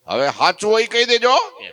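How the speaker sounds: high and loud, pressing for the truth; insistent demand. Acted. Natha demands Maharaj tell the truth, loud and insistent. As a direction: demand an answer at once.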